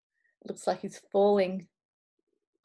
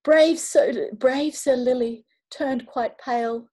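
plain reading voice

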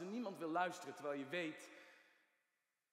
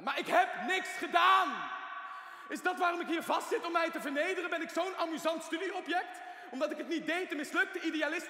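shouted much higher and louder throughout; furious outburst; 9 semitones higher, 10 dB louder than usual. Acted. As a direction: explode with rage at the accusation. Patient erupts at the accusation. (woedende uitbarsting, hoog en luid)

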